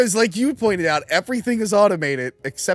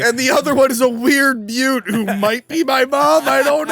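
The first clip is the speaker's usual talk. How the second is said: mock anguished wail; 7 semitones higher, 4 dB louder than usual. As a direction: build to a hammed-up shout of despair. Continues Shinji's anguished lament.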